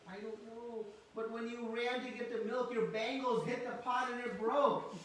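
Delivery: Krishna. Acted. Child explains the broken pot to his mother, protesting.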